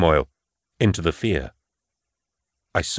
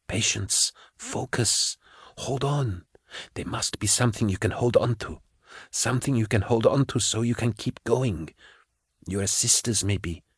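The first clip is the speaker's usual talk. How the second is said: much softer, gentle reassuring voice; soft reassuring Kara; 2 semitones higher, 8 dB quieter than usual. A narrator's reading. Kara speaking softly into Patience's mind to calm her. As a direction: soothe her quietly and steadily. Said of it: "Kara Swole's soft, reassuring tones".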